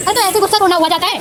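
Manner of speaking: high, loud playful scolding of a pet; high fast mock telling-off. Real, not acted. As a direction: tell off the pet, half joking.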